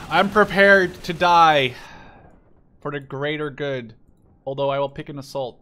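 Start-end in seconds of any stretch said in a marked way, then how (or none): 0.1-3.9 slow, loud hammed-up vow; mock heroic. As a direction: declare it like a doomed hero. Mock heroic declaration before attack.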